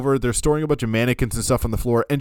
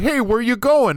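higher, hammed-up shout after someone running off; mock call after someone; 10 semitones higher, 4 dB louder than usual. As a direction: yell after him like a clueless hero. Stanley chases after the killer.

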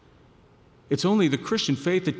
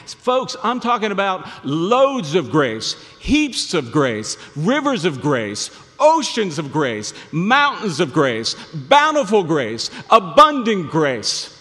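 louder and louder, each grace word hit; building preaching; 4 semitones higher, 7 dB louder, slower than usual. Real, not acted. Preacher rousing the congregation about grace, building loud. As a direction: pile up the grace words, rising to a climax.

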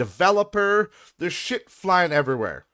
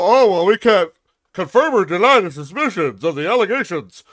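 louder mock corporate spokesman; corporate dodge; 3 semitones higher, 6 dB louder than usual. Mocking how companies dodge complaints, corporate voice. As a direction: parody a stiff company statement. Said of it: "They go".

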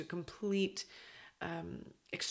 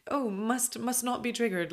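mock prim; 5 semitones higher, 4 dB louder than usual. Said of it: "that's not really how it happens".

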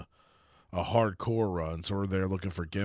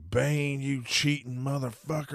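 pitched-up imitation of an angry yell; retold shout; 6 semitones higher than usual. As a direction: imitate a heckler hollering, at talking volume. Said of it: "And he just shouted".

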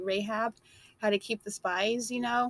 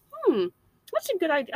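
mock agreement; 5 semitones higher than usual. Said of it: "be quiet and be like".

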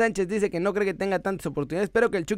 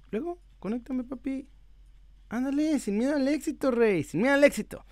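coaxing; 6 semitones higher than usual. Pretend. Coaxing the app to connect.

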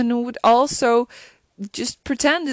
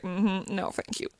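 quiet dry refusal; 3 semitones lower, 10 dB quieter than usual. Retold or imitated. Acting Simon's dry refusal.

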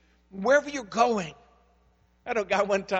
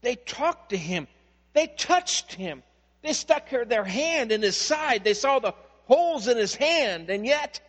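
louder and higher, building line by line; rising preacher fervor; 4 semitones higher, 6 dB louder, slower than usual. Real, not acted. Pastor to congregation, building to a loud, fervent climax. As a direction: drive the crescendo home like a revival pulpit.

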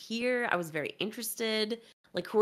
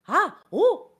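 high, loud Italian-style exclamations, much higher and louder; imitating Luigi; 13 semitones higher, 8 dB louder than usual. Mocking Luigi's two trailer lines for a co-host, high loud Italian cries. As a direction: yelp them out like a cartoon Italian plumber. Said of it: "he literally is like".

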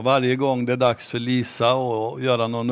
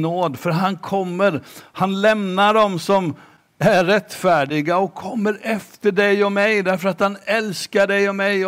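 preaching; 5 semitones higher than usual. Acted. Preacher urges the congregation about Jesus's grace, building appeal.

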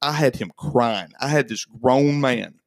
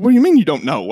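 louder, higher furious question; retold angry demand; 5 semitones higher, 8 dB louder, faster than usual. Owner grilling the mechanic about the hole, louder, angry. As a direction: demand an answer, fuming. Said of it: "I said".

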